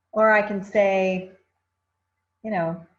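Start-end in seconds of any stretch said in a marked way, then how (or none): none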